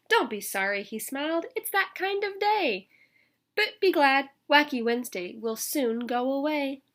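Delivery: lively storybook read-aloud; reading to children. A narrator's reading. Teacher reading a picture book to children, lively. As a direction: read it out to the kids with bounce.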